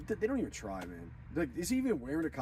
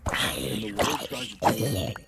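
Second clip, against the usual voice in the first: loud whisper; 4 semitones lower, 8 dB louder, much slower than usual. Acted. Preaching against the Beast.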